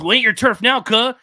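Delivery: loud, high tough-guy threat; gangster threat. Retold or imitated. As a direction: bark it like a street gangster guarding turf. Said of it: "he goes".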